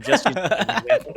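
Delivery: quick words through a laugh; chuckling, fast and amused. Real, not acted. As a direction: laugh it off as you explain. Laughing at own joke.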